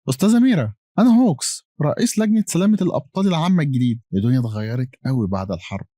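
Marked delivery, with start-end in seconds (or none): 0.1-4.0 higher, louder greeting, lifted from the first word; upbeat phone introduction. Acted. New commission chairman introducing himself on a call, upbeat and high-pitched. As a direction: introduce yourself brightly in a raised pitch.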